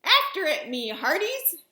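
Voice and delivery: loud barked order; pirate command. Acted. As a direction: send the crew after the ball.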